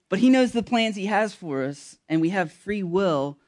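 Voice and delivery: ordinary delivery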